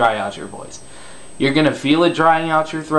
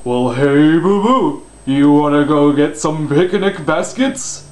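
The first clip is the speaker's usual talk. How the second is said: Yogi Bear; 3 semitones higher, 4 dB louder, slower than usual. Shown as a demonstration. Coach demonstrating the false voice for false chords, Yogi Bear impression. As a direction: put on a big slow false voice with stretched vowels. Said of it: "let's take Yogi the Bear. You just talk".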